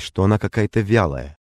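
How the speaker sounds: plain reading voice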